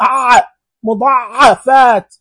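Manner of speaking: العين in أع stressed hard and drawn out; heavy ain demo. Shown as a demonstration. Teacher to pupils, heavy ain drawn out. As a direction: lean hard on the ع in مضعفات. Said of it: "وش الحرف اللي ثقيل؟".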